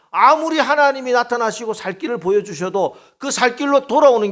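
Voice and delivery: high, loud preaching cry; fiery preaching. Acted. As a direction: preach it with rising fire. Preaching at full force. (뜨겁게 외치는 설교)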